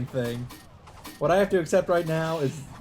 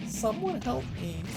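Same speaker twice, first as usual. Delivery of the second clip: put-on girl's plea; 9 semitones higher, 5 dB quieter than usual. Girl mouths plea for help.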